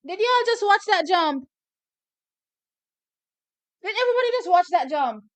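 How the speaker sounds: loud, high incredulous question; excited disbelief. Real, not acted. Fan asking the livestream viewers in loud disbelief. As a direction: demand they saw it too.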